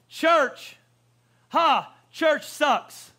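high, loud mocking scoff, slowed right down; scoffing Nathanael. Nathanael scoffing at church, high and loud. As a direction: sneer at the church with a laugh. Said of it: "What he's saying is".

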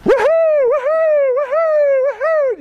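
very high, drawn-out whooping; celebratory yelling. Acted. Neighbor running round the yard at dawn, high ecstatic whooping. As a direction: whoop in long high cries. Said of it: "running around the yard yelling".

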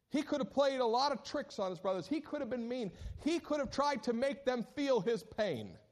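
high, loud insistent preaching, lines 110 to 112; fervent preaching. Acted. Preacher to congregation about what Joseph could have done, fervent preaching. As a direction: drive it home from the pulpit.

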